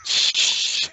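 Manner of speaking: long voiceless hush; urgent shush. Acted. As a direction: shush a buddy in character. Grodd hushes his buddy.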